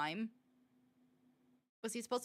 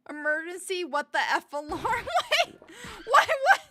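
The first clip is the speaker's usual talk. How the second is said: much higher and louder, rising to a yelp; panicked confused cries; alarmed disbelief; 8 semitones higher, 9 dB louder than usual. Real, not acted. Alarm goes off in clip.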